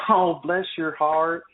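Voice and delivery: slow, sugary "Oh, bless your heart" with a hidden insult; sweet on top, a put-down underneath, drawn out; mock sweet. Southerner brushing off a late excuse, falsely sweet and slow. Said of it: "And they're just like".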